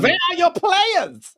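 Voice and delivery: high, mock-indignant coach impression; hammed-up demand, pitched well above normal; Hansi Flick. Host does a requested coach bit, high put-on voice. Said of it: "a, a Hansi Flick, uh, thing".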